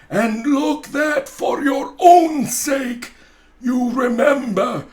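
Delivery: loud, high, slow spectral wail; booming ghost. Acted. Marley's ghost warning Scrooge, loud and wailing. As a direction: proclaim it like a doomed ghost.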